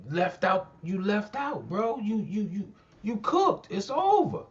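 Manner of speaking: heated roast of a rival rapper, building to a high finish; rising heated taunt. Real, not acted. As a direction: push the taunt up and up until it tops out. Mocking rant climbs to peak.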